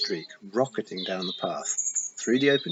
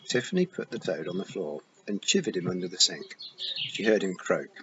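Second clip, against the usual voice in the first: usual speaking voice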